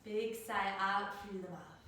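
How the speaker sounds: ordinary delivery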